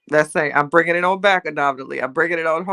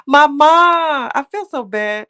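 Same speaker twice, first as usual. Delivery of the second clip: high, loud cry of Mama; excited call; 11 semitones higher, 5 dB louder, slower than usual. Real, not acted. Spotting her mother in the chat and calling out loudly.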